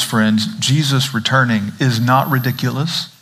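ordinary delivery

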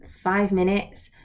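ordinary talk

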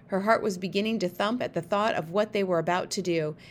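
usual speaking voice

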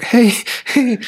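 high, tentative little hey; acted careful approach to a defeated partner; cautious greeting. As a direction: tiptoe in with a high-pitched hello.

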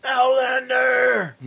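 very high, slow, loud shout; drawn-out villain bellow; Outlander shout. Retold or imitated. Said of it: "I just gotta repeat that".

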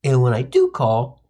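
usual speaking voice

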